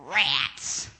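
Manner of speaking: low, glum "Rats"; a deflated little groan; Charlie Brown's groan. Retold or imitated. Charlie Brown flat on his back, low glum groan. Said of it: "and he goes".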